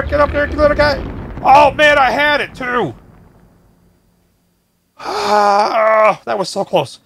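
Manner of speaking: ordinary talk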